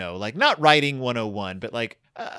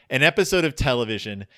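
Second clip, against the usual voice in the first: ordinary talk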